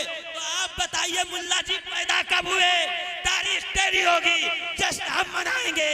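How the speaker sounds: loud, high, triumphant challenge to the mullah; defiant shout. Retold or imitated. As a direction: hurl the challenge back at them. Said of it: "पहले ही फुर्सत में कह रहे हैं". (मुल्ला जी को ललकारते हुए ऊँची आवाज़ में)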